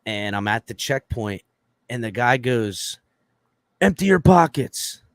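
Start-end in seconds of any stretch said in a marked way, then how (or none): 3.8-5.0 loud, high-pitched mock command; imitated screamed order. Guard orders man at checkpoint, imitated screamed order. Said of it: "started fucking screaming".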